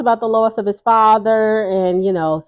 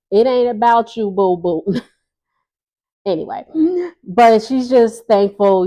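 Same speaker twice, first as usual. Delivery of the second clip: usual speaking voice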